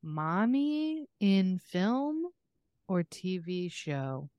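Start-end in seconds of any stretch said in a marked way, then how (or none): none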